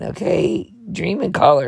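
ordinary delivery

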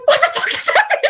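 very high, loud shriek; mock-shocked squeal at top of the range; retold alarm. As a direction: exclaim it in high-pitched disbelief. Retold alarm as fight breaks out.

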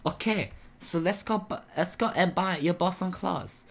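high put-on character voice; helpful game character. Character suggesting a shopping trip, high character voice. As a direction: pitch it up like a visual-novel character.

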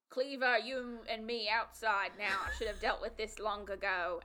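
ordinary talk, nothing marked

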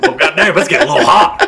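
high, loud hammed-up holler; laughing mock yell; mock country boy. Mocks country kid's voice.

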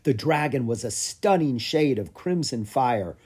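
ordinary delivery

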